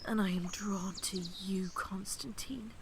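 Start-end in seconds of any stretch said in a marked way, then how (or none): 1.7-2.7 whispered name. Acted.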